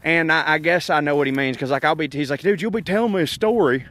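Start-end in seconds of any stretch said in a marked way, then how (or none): 2.4-3.9 lightly voiced buddy, a touch higher; a hint of a drawling friend; impression of Trey. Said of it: "That's my impression of Trey".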